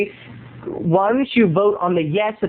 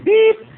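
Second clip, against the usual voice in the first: imitated beep; 4 dB louder than usual.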